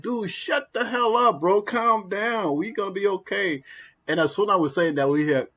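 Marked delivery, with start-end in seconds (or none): 0.0-3.6 high, slow, exasperated shout, imitating; exasperated yelling. Friends snapping at the screaming coworker in the elevator, high mock yell. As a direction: tell him to shut up, fed up and pitched high. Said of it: "and we like".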